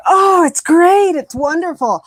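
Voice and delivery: slow, high, loud gushing over praise; mock gushing. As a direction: lay on the delight thickly. Mocking praise reaction.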